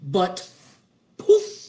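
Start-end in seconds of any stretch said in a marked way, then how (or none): 1.2-1.6 high, loud puff of a spell; sudden airy "poof" sound effect; magic poof. Acted. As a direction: pop it out like a spell going off.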